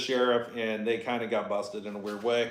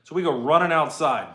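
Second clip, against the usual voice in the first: usual speaking voice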